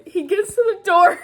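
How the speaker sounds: high, loud laughing delivery; half cracking up. Real, not acted.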